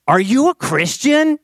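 loud, high-pitched, in-your-face question; intense stranger's question. Retold or imitated. Abrupt question from stranger in his face.

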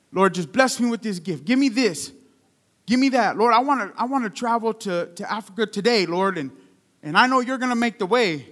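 mock pleading, lifted in pitch; imitated pleading prayer. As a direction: mimic an eager believer petitioning God. Imitates believers begging God for gifts.

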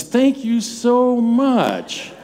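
high, slow, gushing mock thank-you; exaggerated thanks. Pastor redoes his forgotten thanks, gushing and slow. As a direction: overdo the gratitude to make up for it. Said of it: "Did I do better?".

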